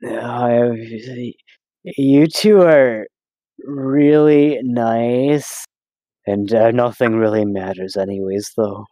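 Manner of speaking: very slow, spaced-out stoner drawl, sinking low. Acted. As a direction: play it stoned, words stretched way out. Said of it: "you relapse a little bit into the high".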